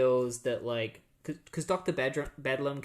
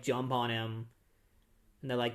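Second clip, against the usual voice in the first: ordinary delivery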